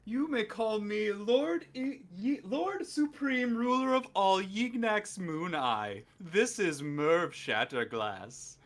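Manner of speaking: slow pompous self-announcement; grand slow introduction. Acted. Self-styled lord introducing himself, slow and pompous. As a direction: take your time, lordly.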